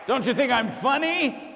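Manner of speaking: loud, high showman shouting to the room; big, high-energy stage shout; hyped-up lounge host. Acted. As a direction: work the crowd like a club emcee. Lounge host hyping the crowd.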